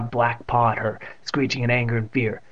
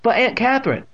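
put-on high boyish protest; pleading; 11 semitones higher, 4 dB louder than usual. A narrator's reading. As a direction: plead with your aunt. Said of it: "Evan started to plead".

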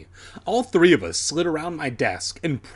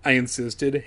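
usual speaking voice

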